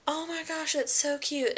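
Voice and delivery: high, doting coo; gushing. Retold or imitated. Mother cooing over her baby's ultrasound, high and doting. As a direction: coo over the baby like you can't help it. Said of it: "I must have said literally, like, five times".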